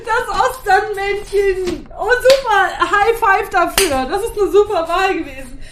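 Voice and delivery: high, laughing delight; thrilled. Real, not acted. Co-host praising the song choice, thrilled and laughing. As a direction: gush with laughter in the voice.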